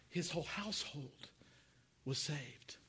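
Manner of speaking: hushed whisper; whispered like a secret. Acted. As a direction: breathe the words out. Hushed climax of story.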